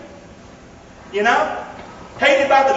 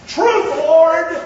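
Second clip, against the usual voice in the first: loud plea; 6 semitones higher, 5 dB louder than usual. Acted. Woman pleads with Jesus.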